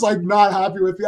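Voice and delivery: normal talking voice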